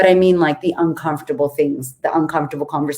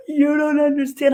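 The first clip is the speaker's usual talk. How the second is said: imitating client; 10 semitones higher than usual. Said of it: "And they're like".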